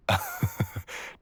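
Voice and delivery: a high, amused burst of laughter; incredulous laugh. Acted. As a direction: laugh at the cheek of it. Amused at negotiation attempt.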